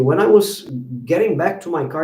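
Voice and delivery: ordinary delivery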